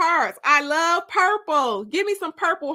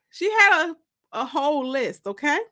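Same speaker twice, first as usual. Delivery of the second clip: ordinary delivery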